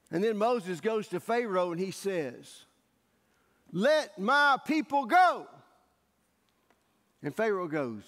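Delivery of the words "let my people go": slow, loud, higher command; commanding demand. Retold or imitated. Moses demands Pharaoh free his people, slow loud command. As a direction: declare it to Pharaoh.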